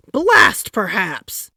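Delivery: slow, loud "Blast perhaps"; shouted curse. A narrator's reading. Taft shouting down his wife's idea. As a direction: bellow it in frustration. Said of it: "shouted Taft".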